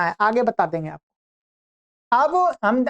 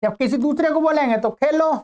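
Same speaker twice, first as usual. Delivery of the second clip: ordinary talk, nothing marked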